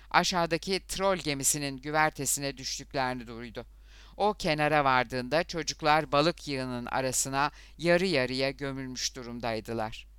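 plain reading voice

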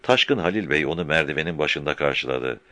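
normal talking voice

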